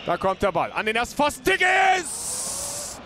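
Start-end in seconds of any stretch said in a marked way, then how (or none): 1.4-3.0 excited call. Acted. Header chance called out.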